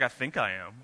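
father admitting he is stubborn too, sheepish and quiet; sheepish admission. Retold or imitated. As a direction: mumble it like you've been caught. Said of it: "I was like".

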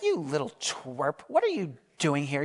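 furious big brother. Acted. Oldest brother scorning young David, low sneer. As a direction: belittle him under your breath. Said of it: "was furious".

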